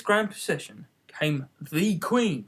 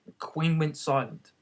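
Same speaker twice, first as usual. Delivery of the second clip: ordinary delivery, nothing marked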